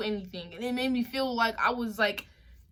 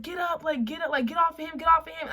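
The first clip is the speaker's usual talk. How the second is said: yelling; 5 semitones higher than usual. Retold or imitated. Yelling at cops to stop.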